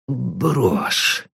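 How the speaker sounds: a soft, timid near-whisper; old pawnbroker timidly asks Paul about the brooch, barely audible. A narrator's reading. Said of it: "робко прошептал Аарон".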